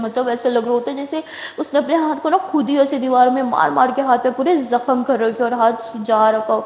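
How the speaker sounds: ordinary delivery, nothing marked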